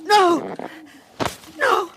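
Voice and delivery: high, desperate screams; screaming no. Acted. A possessed woman screaming as the spirit comes. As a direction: scream it again and again. Repeated screams during seance.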